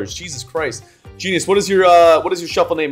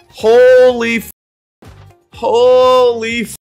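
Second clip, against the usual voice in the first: slow, loud, amazed gasp of words; stunned drawn-out exclamation; 5 semitones higher, 5 dB louder, much slower than usual. Real, not acted. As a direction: stretch it out in disbelief. Amazed at the win.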